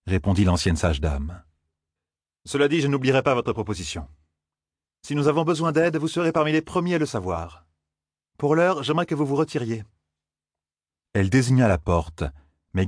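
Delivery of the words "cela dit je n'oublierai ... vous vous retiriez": higher-pitched, firm woman's voice; politely but firmly dismiss them; raised pitch, composed authority; former wise woman, firm. A narrator's reading.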